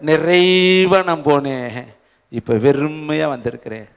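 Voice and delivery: low, soft and very slow grief; mournful Naomi. Retold or imitated. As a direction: sigh out her emptiness. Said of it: "நகோமி சொல்றாங்க".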